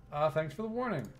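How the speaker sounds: normal talking voice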